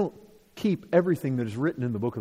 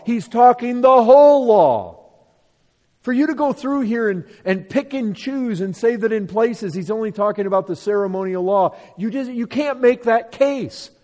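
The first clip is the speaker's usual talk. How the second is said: emphatic, raised pulpit argument; forceful preaching; 5 semitones higher, 7 dB louder than usual. Real, not acted. Preacher proving that the whole law is meant, forceful preaching. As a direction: hammer each point home, landing hard on key words.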